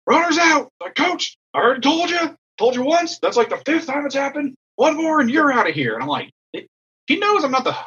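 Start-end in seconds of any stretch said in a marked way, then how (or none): 0.1-5.9 high-pitched, worked-up mock ruling; acted-out ejection warning, pitched way up; irate umpire call. Umpire calls runner out, threatens ejection. 7.1-7.7 high-pitched objection; acted-out indignation; retold indignant protest. Coach protests to the umpire.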